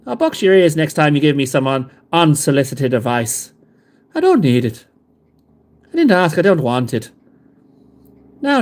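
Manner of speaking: scolding mock Irish brogue; a hint of a grumpy Irish uncle scolding; Irish accent. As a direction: tell Nara off in a hammed-up Irish accent. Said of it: "sounds like we've got an Irish off".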